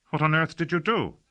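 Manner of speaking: high, loud startled question; shocked. A narrator's reading. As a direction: blurt it out in shock. Casher shocked by what she did.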